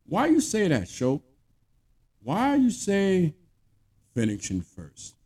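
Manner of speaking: high-pitched mock complaint, falling away at the end; mock objection. Mock objection from offended people.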